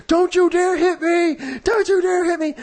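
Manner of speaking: very high, drawn-out mock shriek; imitated scream. Man screaming at the girl who whacked him, high mock shriek. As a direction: shriek it slowly, in imitation of outrage. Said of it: "he screams at her".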